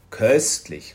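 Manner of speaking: mild exclamation of delight; exclaiming. A narrator's reading. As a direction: call it out with relish. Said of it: "rief er".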